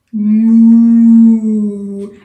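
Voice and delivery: long very loud moo; cow moo. Shown as a demonstration. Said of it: "What does a cow sound like".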